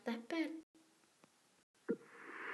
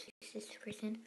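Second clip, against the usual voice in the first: whispering; 8 dB quieter than usual. Real, not acted. Unvoiced whisper.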